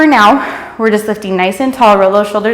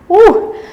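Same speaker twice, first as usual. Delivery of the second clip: excited whoop; 11 semitones higher than usual. Real, not acted. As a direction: cheer through the burn. Instructor cheering, muscles burning.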